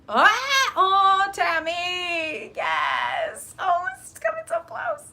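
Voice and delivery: high, loud delighted cries to viewer Tammy; squealing with excitement at Tammy's message; excited squeal. Real, not acted. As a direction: burst into thrilled squeals over a viewer's news.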